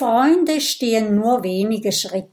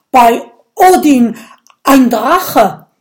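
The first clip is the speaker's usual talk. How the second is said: loud, higher startled cry; startled shout; 4 semitones higher, 12 dB louder than usual. Acted. Viking startled by the dragon, loud frightened cry. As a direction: jump up and yell in fright. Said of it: "springt erschrocken auf".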